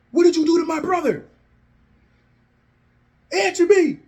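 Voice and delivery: high, loud, strained demand; angry confrontation. Acted. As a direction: shout it at the attacker, voice way up. Jay confronting the attacker.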